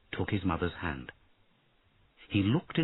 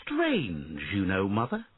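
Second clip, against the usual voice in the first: put-on a boy puzzling aloud to his mother; young prince voice; 7 semitones higher than usual. A narrator's reading.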